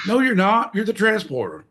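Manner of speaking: mock outrage. Mocking correction, pitched up.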